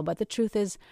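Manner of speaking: usual speaking voice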